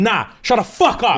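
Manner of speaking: high, angry snap; angry retort. Retold or imitated. Raging dragon silencing Varys: high angry snap. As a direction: cut him off hard.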